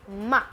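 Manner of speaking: मा short and light with no tone; neutral tone ma. Shown as a demonstration. Teacher showing toneless question particle. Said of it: "जो question particle है".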